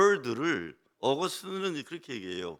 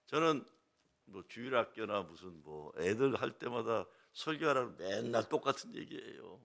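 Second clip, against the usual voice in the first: normal talking voice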